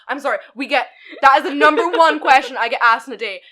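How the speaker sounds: usual speaking voice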